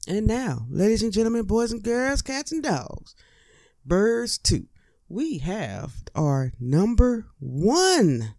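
hammed-up showman build to number one; mock announcer. Comic presenting his list's top item, mock announcer. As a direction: announce the top spot like a game show host.

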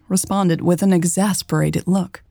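ordinary delivery

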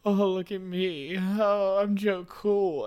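high-pitched, drawn-out mocking voice; mocking Joe Burrows. Fan mocking the rival quarterback, high show-off voice. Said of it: "They got Joe Burrows with his".

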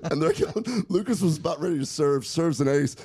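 ordinary talk, nothing marked

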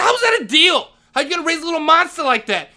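outraged yell. Retold or imitated. Janitor questioning his parenting, outraged yell. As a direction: shout in disbelief. Retold shouting match with principal.

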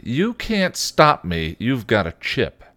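put-on high, drawn-out teenage challenge; stubborn defiance. A narrator's reading. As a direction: dig in like a defiant kid sister. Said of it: "set her jaw stubbornly".

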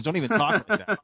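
high scolding wife voice, lightly put on; mock superstitious wife. Wife scolding husband for jinxing the game, high mock scold. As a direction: raise the pitch and snap it like a nervous wife. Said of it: "She goes".

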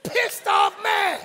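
high, slow, loud pissed off; mock anger. Shown as a demonstration. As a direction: play up the anger for the congregation. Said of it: "can you say that in church".

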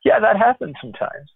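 amused, easygoing chuckle; laughing it off. Retold or imitated. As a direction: shrug it off with a laugh.

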